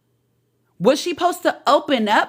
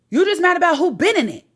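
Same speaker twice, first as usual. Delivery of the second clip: ordinary delivery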